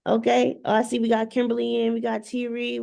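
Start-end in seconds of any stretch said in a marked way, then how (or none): none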